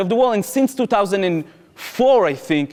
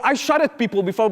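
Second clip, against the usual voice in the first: ordinary talk, nothing marked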